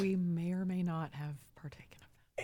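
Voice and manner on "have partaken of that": playful conspiratorial whisper. Real, not acted. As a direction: whisper it like a secret. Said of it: "Why are we whispering?".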